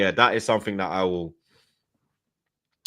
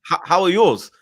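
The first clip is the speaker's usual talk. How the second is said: starstruck stammer, pitched up with a laugh; stuttering; 6 semitones higher than usual. Retold or imitated. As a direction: trip over the word how, flustered. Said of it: "I started stuttering like".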